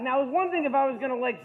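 ordinary delivery

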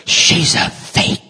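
low, slow catty murmur, fading to a breathy whisper on the last words; mock church gossip sneering at her; gossipy sneer. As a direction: whisper the dig like a pew gossip. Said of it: "third pew every week going".

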